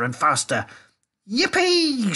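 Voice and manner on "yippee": very high, drawn-out whoop; gleeful shout. A narrator's reading. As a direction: whoop with joy. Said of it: "shouted Grandpa Joe again".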